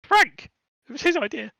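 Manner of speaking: squeaky high panicked kobold voice; a terrified little thief babbling apologies; blubbering kobold. Acted. Kobold caught stealing carrots, panicked high squeak. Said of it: "the kobold kind of glances around and goes".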